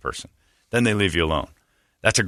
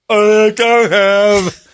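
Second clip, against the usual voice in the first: much higher, loud and very slow put-on voice; mocking mentally challenged voice; 11 semitones higher, 6 dB louder, much slower than usual. In a mock mentally challenged voice to dodge the beggar. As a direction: drag it out high and loud like a caricature. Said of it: "he went full R word".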